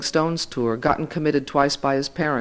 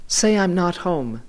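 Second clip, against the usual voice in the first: usual speaking voice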